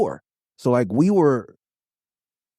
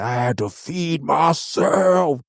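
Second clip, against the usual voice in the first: imitating Aesop; 6 semitones higher than usual. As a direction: mimic him dragging the words.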